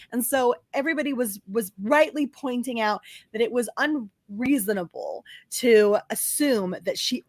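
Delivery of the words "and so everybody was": ordinary delivery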